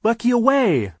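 very high, loud yell; shouted counter-order. A narrator's reading. Sister overrules the foreman, shouting high.

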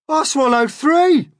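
very high, loud, slow Centipede; boastful cry. A narrator's reading. Centipede outdoes the Earthworm, boastful cry. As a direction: crow it to top the others. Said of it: "the Centipede cried".

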